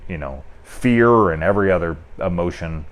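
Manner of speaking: normal talking voice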